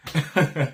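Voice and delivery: quick real chuckle; short laugh. Real, not acted. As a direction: laugh at yourself. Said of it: "slurring my words laughs".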